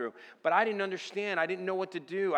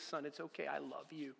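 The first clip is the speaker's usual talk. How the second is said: soft, hushed and reassuring; a gentle, loving God consoling his son; tender voice of God; 5 dB quieter than usual. Retold or imitated. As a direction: comfort him quietly like a loving father. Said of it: "He goes".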